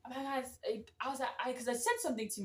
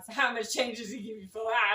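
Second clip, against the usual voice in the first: louder mock-manager question; 5 dB louder than usual. Manager asking in front of customers, out loud. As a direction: imitate a manager asking loudly for all to hear. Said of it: "she goes out loud".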